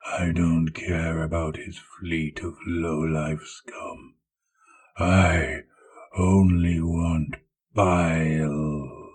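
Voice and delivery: growled. Acted. Warlord dismissing the fleet to hunt Bile, growling. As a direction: rumble each word with menace. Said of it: "Typhus growled".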